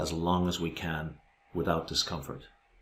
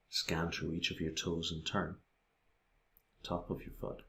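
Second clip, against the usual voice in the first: soft, hushed meditation voice with long pauses; guided meditation; 8 dB quieter than usual. Acted. Guiding listeners through a body scan of the feet, hushed meditation voice. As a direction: guide attention softly, leaving space between phrases.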